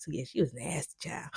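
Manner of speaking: soft whisper; quiet whispered confession; whispered aside. Real, not acted. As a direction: let it slip in a whisper. Confiding aside about the scene.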